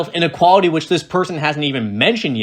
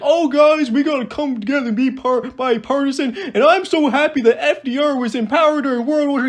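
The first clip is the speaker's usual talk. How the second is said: high, giddy mock voice, laughing; high-pitched parody of a gushing politician; mock Josh Hawley; 11 semitones higher than usual. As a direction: ham up a gushing bipartisan senator, giggling. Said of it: "Imagine if Josh Hawley came out and he's like".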